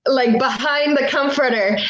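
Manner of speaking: normal talking voice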